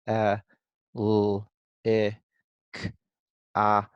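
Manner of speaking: "Uh, l, I, k, a" as single sounds, a pause after each; sounding out. Shown as a demonstration. Teacher counts the sounds on fingers, sounding out. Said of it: "sound it out just with my fingers".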